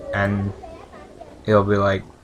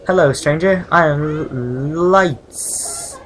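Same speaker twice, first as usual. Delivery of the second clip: put-on higher, hesitant girl's voice; naive servile girl; 5 semitones higher, 4 dB louder, much slower than usual. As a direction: play a curious little servant, drawing out the name. Voices young servile girl meeting stranger.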